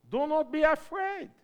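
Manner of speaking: a bold rallying cry to the church; loud call. Shown as a demonstration. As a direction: proclaim it to the whole room. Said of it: "Then do not be afraid".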